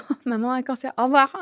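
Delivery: tossing off terrible news as if it were nothing, with ironic lightness; mock cheery announcement. Mother mocking telling her daughters, mock breezy. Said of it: "hors de question que je leur dise".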